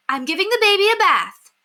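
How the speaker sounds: busy mother calling down to the paperboy. A narrator's reading. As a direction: call down over the noise. Miss Kelly still calling down.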